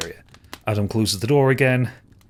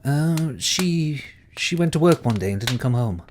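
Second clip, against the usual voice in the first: put-on child voice; 5 semitones higher than usual. Child Caitlin about her mother.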